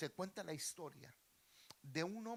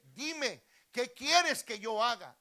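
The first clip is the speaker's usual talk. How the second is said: raised, booming royal reply; loud king; 7 semitones higher, 16 dB louder, slower than usual. Acted. King answering a beggar's call, loud and commanding. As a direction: call back as a king, projected.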